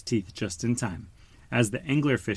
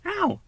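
sharp high "Ow!"; yelp of pain. A narrator's reading. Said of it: "Dory cried".

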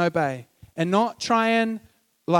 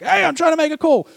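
higher, louder annoyed shout down the line; annoyed phone caller; 10 semitones higher, 4 dB louder, faster than usual. Retold or imitated. Kid on the shared line, annoyed mock yell. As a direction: snap at whoever picked up the other phone. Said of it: "you're like".

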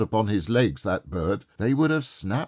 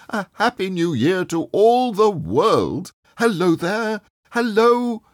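high, louder, bubbling with delight; giddy old man shouting greetings to all; giddy joy; 10 semitones higher, 4 dB louder than usual. A narrator's reading. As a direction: burst out in gleeful greetings.

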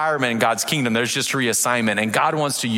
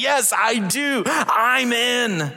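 eager yes; 10 semitones higher, much slower than usual. Pretend.

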